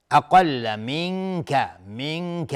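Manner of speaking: منک with the n held as a nasal hum; ikhfa. Shown as a demonstration. Teacher demonstrating the rule, منک said with a long nasal. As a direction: stretch the hidden n of منک before ک. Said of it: "اخفای حقیقی".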